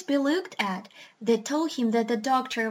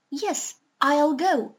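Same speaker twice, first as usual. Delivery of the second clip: agreeing creature; 4 semitones higher, 3 dB louder, slower than usual. Acted. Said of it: "said the push-me-pulley".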